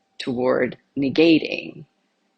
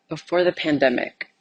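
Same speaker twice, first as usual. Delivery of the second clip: ordinary talk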